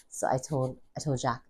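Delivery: normal talking voice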